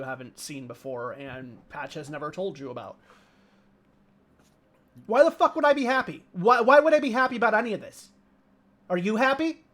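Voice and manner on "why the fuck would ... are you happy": high, loud, fast furious shouting; angry yell. Acted. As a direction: scream it at your brother, at full force. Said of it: "her, like, yell".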